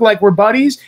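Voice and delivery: ordinary talk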